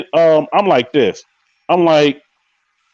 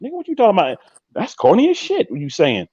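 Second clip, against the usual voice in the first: dismissive woman; 5 semitones higher than usual. Retold or imitated. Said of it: "I can't even mimic it. It's the face".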